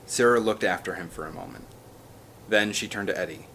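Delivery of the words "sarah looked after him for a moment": plain reading voice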